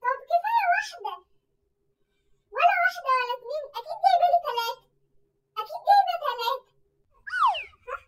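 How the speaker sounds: loud, high insistent guessing; loud teasing. Acted. A sister loudly guesses how many pizzas she bought. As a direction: press her loudly with each guess.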